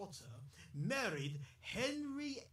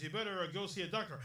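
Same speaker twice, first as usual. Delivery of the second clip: ordinary talk, nothing marked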